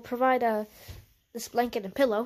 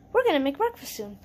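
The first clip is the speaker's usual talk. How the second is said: ordinary talk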